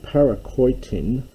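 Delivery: ordinary talk, nothing marked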